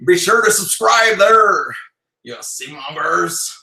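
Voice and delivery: slow, put-on pirate sign-off. Husband trying a sign-off for viewers, pirate voice. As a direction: stretch it out like a hammy pirate. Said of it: "You try".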